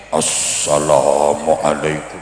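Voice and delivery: very low, slow, drawn-out greeting; big preacher voice. Shown as a demonstration. Preacher imitating an orator opening a speech, big deep voice. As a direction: blow the voice up big and deep, stretching each vowel. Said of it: "Biasanya suaranya digedek-gedek".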